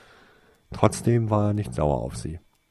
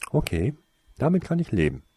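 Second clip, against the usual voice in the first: higher-pitched, conceding reply; mollified, pitched up; relenting; 7 semitones higher, faster than usual. A narrator's reading. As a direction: give in and accept it. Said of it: "lenkte sie ein".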